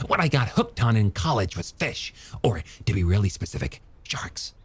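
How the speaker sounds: high, quick, animated character voice; enthusiastic young scientist. Acted. Scientist telling her how he got hooked, high eager voice. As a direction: tell it eagerly, pitched well up.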